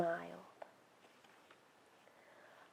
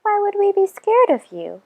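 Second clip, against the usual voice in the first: put-on a much higher, bright-eyed kid voice; high child's reply; 11 semitones higher than usual. A narrator's reading. Child answering the lonely creature, high and curious. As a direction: lift the voice way up for the other child. Other voice replies to Rumple.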